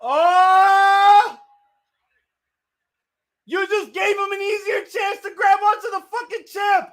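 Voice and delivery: loud, very high frustrated yell; real fan outrage at a mistake; exasperated shout. Real, not acted. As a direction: scream your frustration at the screen. Yelling at botched move.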